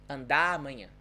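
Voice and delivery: "andar amanhã" run together as "andamanhã", with no R; dropped R. Shown as a demonstration. Teacher repeating the linked words for learners, dropped R. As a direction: connect "andar amanhã" so the vibrating R vanishes. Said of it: "So it just disappears".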